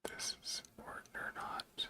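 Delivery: breathy whisper to himself. Real, not acted. Streamer whispering to himself while stuck on the screen. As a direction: whisper it under your breath.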